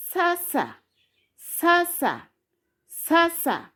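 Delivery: sasa lifted high, sa-sa even; Swahili syllables. Shown as a demonstration. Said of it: "If you combine sa".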